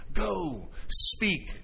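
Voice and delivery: soft, hushed whisper; a gentle whispered command. Shown as a demonstration. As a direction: breathe it like God's whisper. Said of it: "He is whispering".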